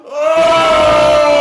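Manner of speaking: huge high shout to the sky; mock battle roar. Mocking the movie's heroes with a sky roar. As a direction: roar it out like a barbarian lifting a trophy. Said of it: "raising something in the sky and going".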